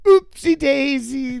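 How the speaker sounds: sweetly mock the angry guy; high, slow, cutesy fake apology; cutesy oopsie. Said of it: "If you go".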